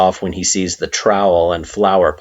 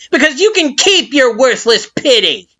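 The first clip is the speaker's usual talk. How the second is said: high, loud contemptuous flower voice; scornful Flowey; 6 dB louder than usual. A narrator's reading. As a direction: throw the pity back at her. Flowey drops mockery, scorns.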